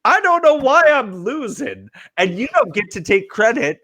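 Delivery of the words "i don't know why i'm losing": higher, whiny mock of a shrugging John; a hint of a clueless whine; mock clueless shrug. Mocking John's excuse for losing, higher whiny tone. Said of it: "shrug your shoulders and go".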